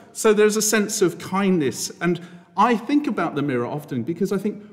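usual speaking voice

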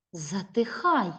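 normal talking voice

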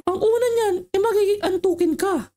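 put-on old lady voice. Old woman telling the kids the symptoms, in a high put-on voice. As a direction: squeak it out like a fussy old lady.

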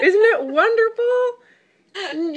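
slow, high laugh-cry; moved to tears and laughing; real tearful laughter; laughing through tears. Real, not acted. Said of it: "You're gonna make me cry".

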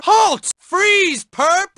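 high, loud police order; commanding shout. Acted. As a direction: bark the order to stop. Guard orders suspect.

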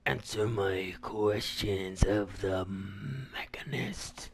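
very slow, low and soft, fading to a whisper; hushed, drawn out. Pretend. Player addressing the Mechanist's terminal, hushed and slow. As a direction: draw it out quietly, trailing into a whisper.

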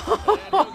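a high, pained burst of laughter; high laugh of disbelief. Real, not acted. Laughs after the yell.

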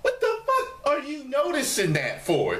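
high, laughing disbelief; incredulous outburst. Retold or imitated. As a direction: throw it back at them, half laughing. Exasperated retort to viewers.

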